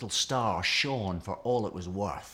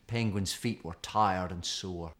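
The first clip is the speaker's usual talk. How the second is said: ordinary delivery, nothing marked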